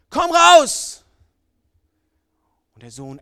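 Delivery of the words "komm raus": loud, high shout of the son's name; furious father calling his son; drunk father's yell. Acted. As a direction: bellow the boy's name across the yard. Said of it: "schreit er".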